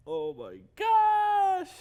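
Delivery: high, stretched oh my gosh; shocked squeal. Real, not acted.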